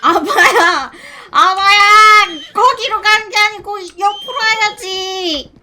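loud high call to a distant horse. Real, not acted. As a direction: shout across the field. Calling out to horse.

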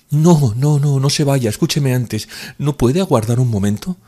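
don't let him leave; Nastenka begging. Acted. Nastenka stops him leaving.

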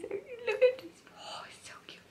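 high breathless whisper. Real, not acted. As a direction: whisper in adoring awe. Cooing softly at toy.

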